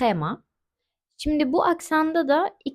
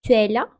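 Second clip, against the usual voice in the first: "tu es là" rising at the end as a question; question tune; 5 dB louder, faster than usual. Shown as a demonstration. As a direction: ask "tu es là", lifting the final "là". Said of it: "Soru cümlesinde ise". ("tu es là" soru tonuyla, sonu yükselerek)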